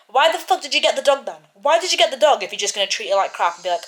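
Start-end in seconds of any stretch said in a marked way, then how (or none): 0.1-2.6 angry rant. Real, not acted. Ranting at the character.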